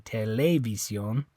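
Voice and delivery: TELEVISIÓN wrongly as te-LEH-vi-syon; TELEVISIÓN with stress moved to LE; stress on second syllable. Shown as a demonstration. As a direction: hit the LE of TELEVISIÓN. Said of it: "It's not that E".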